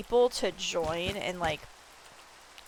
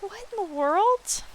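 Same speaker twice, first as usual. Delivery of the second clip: slow, much higher baffled question; retold bewilderment; 11 semitones higher, slower than usual. Streamer retelling being tossed around by bison, bewildered. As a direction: drawl it out, totally lost. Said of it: "And I'm like".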